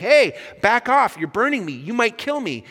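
high, loud acted panic; freaking out. Ghost warding off the burning angel, panicked. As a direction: yelp a warning to keep away. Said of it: "the ghost is starting to freak out".

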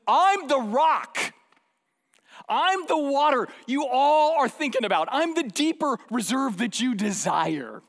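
loud, high and slow, every claim landed; bold declaration. Retold or imitated. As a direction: stand up and proclaim it. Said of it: "now he stands up and says".

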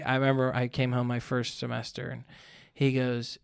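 normal talking voice

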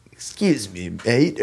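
lightly attempted English accent; a rough stab at a British cop. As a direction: imitate a polite British policeman. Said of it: "in their, like, English accent".

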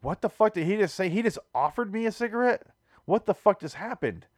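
high-pitched disbelief; incredulous inner outburst. Retold or imitated. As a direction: reel from an offer you can't process. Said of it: "But in my mind, I was like".

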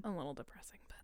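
soft whispered aside. Real, not acted. Friend whispering a gloomy aside. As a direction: drop to a whisper.

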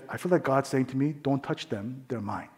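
soft, low voice sinking almost to nothing; hushed revelation. Retold or imitated. Wife telling her husband what God said, hushed.

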